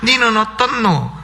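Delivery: comic scripture reading in Gyeongsang dialect. Shown as a demonstration. Preacher giving the congregation a regional psalm, Gyeongsang dialect. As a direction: recite the psalm like a Gyeongsang local. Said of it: "경상도 버전도 있어요".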